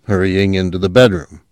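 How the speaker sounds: ordinary delivery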